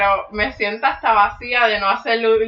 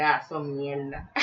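retold dismissive scoff; 7 semitones lower, 8 dB quieter, slower than usual. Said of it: "Y yo dije".

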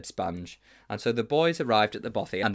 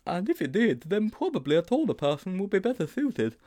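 put-on high-pitched boyish character voice; high, hesitant schoolboy; timid Sponge, reluctant; 9 semitones higher than usual. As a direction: wriggle out of it in a high voice.

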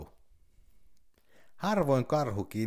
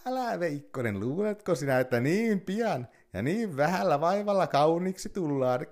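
coaxing fox; 6 semitones higher than usual. Acted. Fox urges burning bear on.

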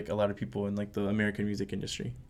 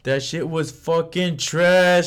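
mock outrage; 7 semitones higher, 8 dB louder, much slower than usual.

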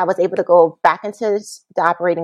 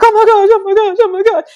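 much higher, louder frantic dad; panicked father; 13 semitones higher, 6 dB louder, faster than usual. Retold or imitated. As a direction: play the panicking father, overlapping gasps.

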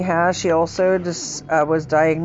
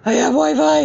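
excited; 10 semitones higher, 5 dB louder than usual. Retold or imitated. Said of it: "I'm like".

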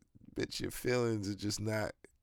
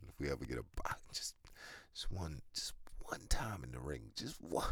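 whispering; 3 semitones lower, 9 dB quieter than usual. Real, not acted. Quiet wish for a fight.

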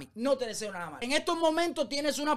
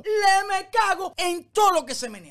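swearing, higher and louder; furious outburst; 7 semitones higher, 5 dB louder than usual. Real, not acted. Vlogger ranting at a singer's praise, furious outburst. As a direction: blow up in disgust at the claim.

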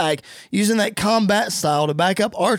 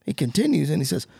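usual speaking voice

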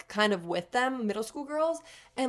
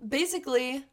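ordinary talk

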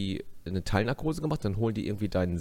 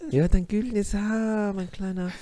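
mock-soothe a little patient in a high voice; säuselnde Krankenschwester; 10 semitones higher than usual. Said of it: "so, die Schwester". (hohe, gedehnte Säuselstimme einer Krankenschwester)

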